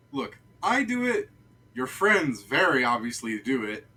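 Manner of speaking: why are you bothering me, exasperated; exasperated guard. Acted. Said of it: "He goes".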